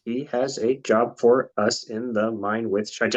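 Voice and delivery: slow, patient re-statement; slowly repeating for the dwarf; slow, spelled-out repeat. Shown as a demonstration. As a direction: say it slowly as if to a child. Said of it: "It's a very simple sentence".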